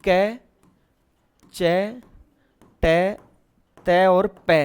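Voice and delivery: each consonant said slowly, one by one; syllables spelled out. Shown as a demonstration. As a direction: sound out कै, चै, टै, तै, पै with pauses. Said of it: "देखो".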